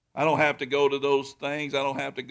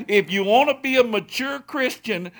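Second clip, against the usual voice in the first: louder, higher preacher's push; preaching hard; 5 semitones higher, 5 dB louder than usual. Acted. Pastor urges his church to start somewhere, preaching louder. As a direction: drive the point home from the pulpit.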